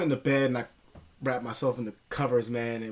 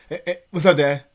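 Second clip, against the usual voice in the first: loud greeting; 9 dB louder than usual. Retold or imitated. Greeting his dad at the door, loud and overeager.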